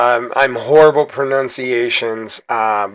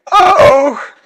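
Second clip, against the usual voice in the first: higher, louder startled cry; alarmed uh-oh; 7 dB louder than usual. Real, not acted. Player watching his characters get deleted, alarmed. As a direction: yelp as the enemy strikes back.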